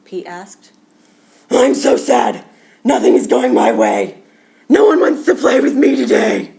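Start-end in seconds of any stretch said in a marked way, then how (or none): 1.5-6.5 loud, booming wail; sad alligator. Acted. Alligator tells Pete no one plays, a loud booming wail. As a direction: bellow the sadness out. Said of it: "Alligator did not look happy".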